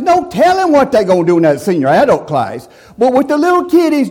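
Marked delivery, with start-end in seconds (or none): 3.0-4.1 mock sweet voice. Mocking tone about kids.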